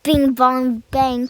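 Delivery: loud, quick run of knocks; imitated bumping noises. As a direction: punch out each impact sound. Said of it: "And then it was".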